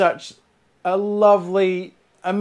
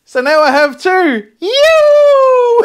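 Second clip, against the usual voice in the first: building to a loud laughing shout; rising to a thrilled, laughing yell; overjoyed laughing cry; excited shout; 8 dB louder, much slower than usual. Real, not acted. Thrilled with second bottle.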